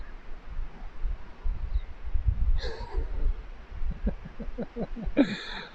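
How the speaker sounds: long, high, breathless laugh; real high-pitched wheezy laughter, trailing on; sympathetic laughing. Real, not acted. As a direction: laugh helplessly on your buddy's behalf. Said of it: "I'm laughing 'cause I feel for you".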